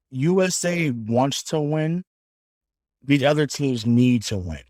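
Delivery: slow, weighty promo read, stress on wants and need; wrestling promo delivery. Shown as a demonstration. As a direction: cut a wrestling promo, one word at a time. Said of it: "that was a great promo right there".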